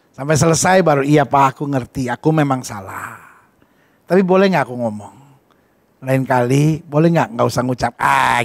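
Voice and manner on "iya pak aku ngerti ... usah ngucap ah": humble gentle reply. Retold or imitated. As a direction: answer meekly and gently. Wife humbly answering husband.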